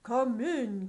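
loud royal call; booming king. Acted. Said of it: "cried the king with a boom".

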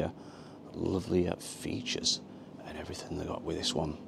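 hushed, almost whispered; quiet voice. Real, not acted. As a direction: keep it low indoors. Invited inside a quiet wine bar.

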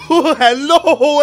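laughing. Real, not acted. Laughing through greeting.

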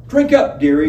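high, loud, brassy urging; Honeybell. A narrator's reading. Honeybell urging the guest to drink up. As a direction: push the drink on him with a shrill cheer.

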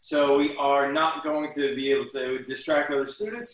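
ordinary talk

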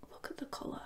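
awed soft whisper. Real, not acted. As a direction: whisper in awe at the colour. Admiring the record colour.

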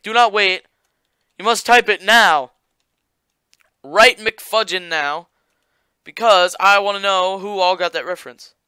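loud, high, pushy command to the viewers; hammed-up order, no waiting; mock urgent demand. Demanding viewers comment immediately.

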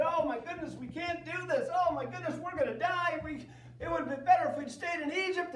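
high, loud frightened wailing; panicked spies. Acted. Fearful spies telling Moses they can't win. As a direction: cry out like scared spies. Ten scared spies report.

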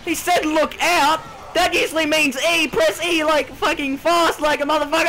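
high, loud hurried shouting; urgent yelling while mashing keys; frantic shouting. Real, not acted. Gamer urging himself to press E fast.

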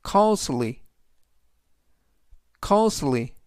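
costly, said carefully as COST-ly; teacher modelling costly; model pronunciation. Shown as a demonstration. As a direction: say costly clearly for repetition. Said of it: "costly"라고 발음하시면 되겠습니다".

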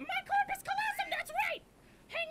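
high excited voice. Acted. Character proudly naming his brain part, high and excited. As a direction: squeak it out with glee.

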